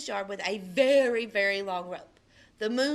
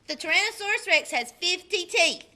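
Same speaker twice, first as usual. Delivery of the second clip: put-on boastful boy; 7 semitones higher, 6 dB louder than usual. Austin lecturing Amy on dinosaurs.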